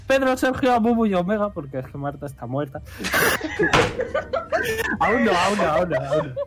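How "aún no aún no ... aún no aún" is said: laughing protest; giggling through the words. Real, not acted. Laughing denial of being dead.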